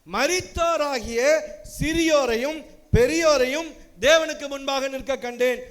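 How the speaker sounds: slow, loud, lifted scripture read; solemn proclamation. Acted. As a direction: call out the verse like a verdict. Reads scripture, slowed.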